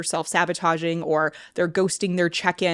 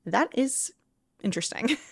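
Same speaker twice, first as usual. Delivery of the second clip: softer, hushed "Wait a second"; quiet realization; 4 semitones higher, 7 dB quieter than usual. Retold or imitated. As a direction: mutter it as a quiet discovery. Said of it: "I was like".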